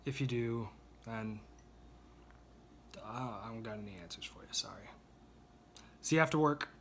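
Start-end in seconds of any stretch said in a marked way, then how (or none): 0.1-4.9 quick whisper, trailing off; whispered aside. Real, not acted. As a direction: say it under your breath. Joking aside under his breath.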